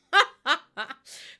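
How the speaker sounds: a short real laugh that falls away; burst of laughter. Real, not acted. Streamer laughing at chat about Jordan, short falling laugh.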